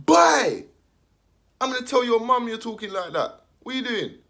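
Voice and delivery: put-on mum's friend yelling at a cheeky kid; scolding yell. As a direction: catch the kid swearing and shout him down.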